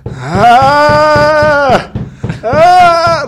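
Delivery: sharp high cries of pain, repeated; pained yelps. Retold or imitated. Groggy patient yelping at the nurse in sharp pained cries. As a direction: yelp out in pain like a patient on the bed. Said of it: "like that on the bed".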